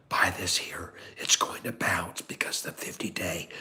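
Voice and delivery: hushed, insistent stock tip; whispering. Real, not acted. Streamer in sister's house pointing out a better chart, whispering. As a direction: whisper it, keeping the volume right down. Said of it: "Why are we whispering?".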